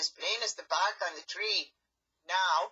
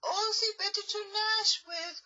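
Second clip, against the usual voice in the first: fake granny voice; 10 semitones higher, 3 dB louder than usual. Wolf posing as granny.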